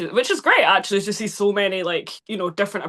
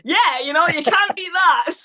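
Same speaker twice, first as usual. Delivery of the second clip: laughing; 9 semitones higher, 4 dB louder than usual. Real, not acted. Joking about playing vampire hunter.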